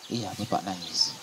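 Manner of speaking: low, very soft, slow father's reply; acted downcast father; subdued admission. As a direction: admit it quietly, voice dropping. Father admits he is crying.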